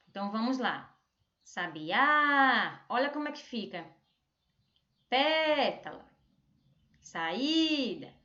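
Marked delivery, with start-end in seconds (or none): 1.4-2.8 Sabiá with a long, loud, open final Á; calling-out stressed syllable. Shown as a demonstration. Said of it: "chamando essa palavra". 5.1-6.0 Pétala with a long, high, open PÉ; PÉ held and raised in Pétala, rest falling away; calling-out stressed syllable. Shown as a demonstration. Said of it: "chamando essa palavra". 7.0-8.1 saída with a long, stressed Í; calling-out stressed syllable. Shown as a demonstration. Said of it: "chamando essa palavra".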